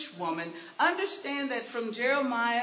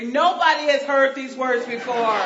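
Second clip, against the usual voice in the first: mock yell at commentary; 9 dB louder than usual. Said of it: "I started yelling at the commentary".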